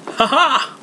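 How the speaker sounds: big high laugh bursting out; jolly nephew laughing; hearty laugh. A narrator's reading. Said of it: "laughed Scrooge's nephew".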